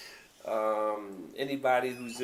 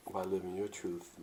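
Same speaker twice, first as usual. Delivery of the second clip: ordinary delivery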